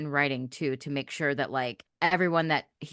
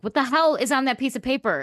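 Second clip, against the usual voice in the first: higher-pitched exasperated outburst; 8 semitones higher, 4 dB louder than usual. Retold or imitated. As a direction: replay your frustrated bafflement, pitched up. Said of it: "It was driving me nuts".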